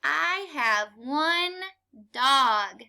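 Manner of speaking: slow, one word at a time; slow choral reading. Shown as a demonstration. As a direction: lead the class slowly. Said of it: "Read it with me".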